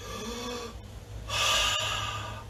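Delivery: big audible breath out; yoga exhale; long breathy exhale. Shown as a demonstration. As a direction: let the air out slowly with a whoosh.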